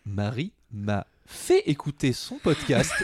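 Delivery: normal talking voice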